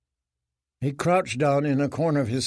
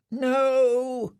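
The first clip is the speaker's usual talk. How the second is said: frightened mumble; 11 semitones higher than usual. Acted. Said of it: "he mumbled".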